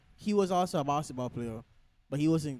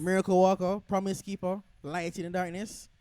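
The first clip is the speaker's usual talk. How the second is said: preaching; 4 semitones higher than usual. Acted. Building preacher-style praise.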